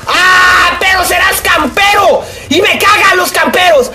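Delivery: real rage yell at a camper; angry shout. Real, not acted. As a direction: scream your hatred at the camper. Killed by camper in game.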